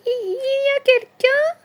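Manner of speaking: very high, slow little voice; tiny voice. A narrator's reading. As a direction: call out timidly in a tiny, high voice. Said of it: "fait une petite voix". (toute petite voix aiguë)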